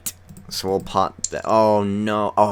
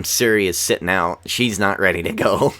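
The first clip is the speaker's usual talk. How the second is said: ordinary delivery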